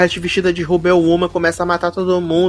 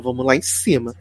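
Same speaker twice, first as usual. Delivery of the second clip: softer and lower, almost secretive; hushed invite; 6 semitones lower, 7 dB quieter than usual. Retold or imitated. Retelling the invite as it should sound, quiet. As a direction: say it low like a discreet invitation. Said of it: "Ela falou".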